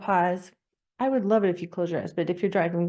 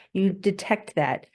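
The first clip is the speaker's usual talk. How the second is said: normal talking voice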